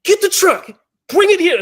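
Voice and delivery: high, very loud command; screaming order. Retold or imitated. Injured rider screaming at his sister to bring the truck. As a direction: yell urgently for it to be brought. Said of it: "I'm, like, screaming at my sister".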